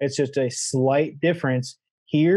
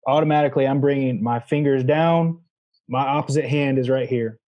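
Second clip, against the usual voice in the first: usual speaking voice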